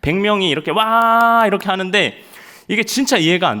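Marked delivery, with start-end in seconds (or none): 0.7-1.4 high, loud whoop; mock soldiers' cheer; drill cheer. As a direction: cheer on command.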